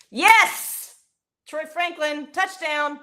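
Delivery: high, loud shout of joy; touchdown yell. Real, not acted. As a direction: celebrate the score. Touchdown celebration.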